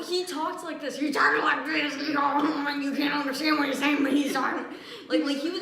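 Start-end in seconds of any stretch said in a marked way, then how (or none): 1.0-2.0 put-on loud, mushy slurred old-man voice. Shown as a demonstration. Imitating the old hoarder's talk, loud slurred speech. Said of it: "He slurred his words a lot". 2.1-4.7 loud garbled old-man impression; slurred old man. Shown as a demonstration. Showing how the old hoarder talks, slurred and loud. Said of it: "He slurred his words a lot".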